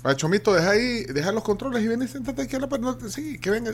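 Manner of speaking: calling across the room, high. Real, not acted. Host calls friend over.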